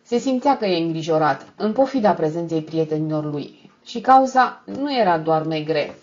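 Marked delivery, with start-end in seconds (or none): none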